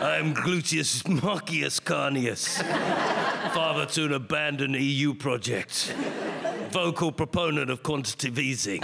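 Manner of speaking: slow mock-epic battle speech, lightly voiced; Carney as Gladiator. Comedian spoofing a leader as a gladiator, mock-heroic and slow. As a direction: ham up a grand gladiator oath, laughing through it. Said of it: "boring Mark Carney suddenly sounding like Gladiator".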